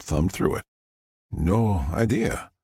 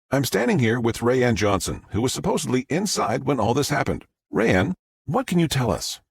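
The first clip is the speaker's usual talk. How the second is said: upbeat live news report voice; TV reporter; 6 semitones higher than usual. Acted. Reporter Fox addresses the camera in a live news voice. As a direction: deliver it like a reporter live on air.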